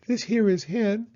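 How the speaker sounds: high, quick owl voice; a busy important owl; fussy owl. Acted. Owl asking the chicks for their mother.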